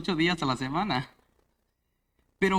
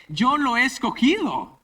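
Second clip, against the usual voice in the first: voice of God; 8 semitones higher, 6 dB louder than usual. Retold or imitated. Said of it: "No, dice".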